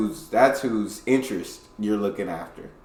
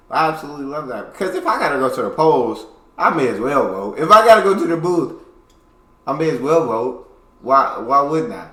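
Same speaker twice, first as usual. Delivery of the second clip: louder and higher, building to a near-shout on the booth; excited insistence; 5 semitones higher, 8 dB louder than usual. Real, not acted. Reacting to an Australian voting clip, excited and emphatic. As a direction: pump it up as you argue your point.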